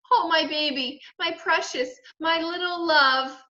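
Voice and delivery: high, cooing endearments; doting mother. A narrator's reading. Mother picking up her hurt boy, cooing.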